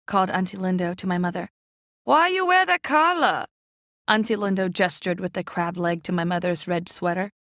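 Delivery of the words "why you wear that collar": high, loud needling question; aunt needling. A narrator's reading. Auntie Lindo needling mother about her sweater, high and loud. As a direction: poke fun at the sweater.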